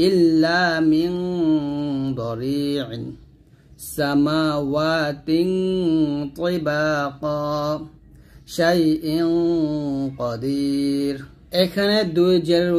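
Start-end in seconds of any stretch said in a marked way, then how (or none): 0.1-11.2 slow recitation with long nasal in "মিং", "সামাওয়াতিন", "শাইইন"; nasal ghunnah. Shown as a demonstration. Teacher reciting for learners, "মিং" with drawn-out nasal. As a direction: hum the closing n of "শাইইন" before going on. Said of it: "অনুচ্ছেদের মতো করে উচ্চারণ করতে হবে".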